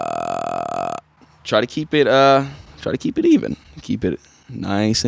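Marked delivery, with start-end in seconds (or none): 0.0-0.9 long low creaky throat fry; sustained grudge noise. Shown as a demonstration. Coach sustaining the grudge noise five seconds. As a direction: hold a low gritty fry. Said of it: "Sustain the grudge noise for five seconds".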